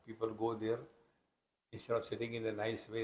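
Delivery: ordinary talk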